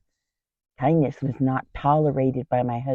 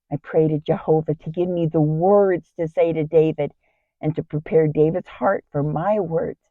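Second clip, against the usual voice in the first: plain reading voice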